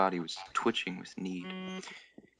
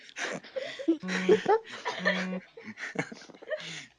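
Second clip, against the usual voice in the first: real laughter; 3 dB louder than usual. Real, not acted. Reader breaks up laughing.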